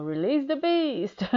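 loud, rising mock-dramatic call; hammed-up egging on; playful urging cry. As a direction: build to a big, high cry, laughing after. Quotes own cry in bed.

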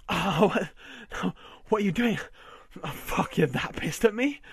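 high and loud, startled; shocked. Acted. Husband shocked by what his wife is doing. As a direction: gasp it out in disbelief.

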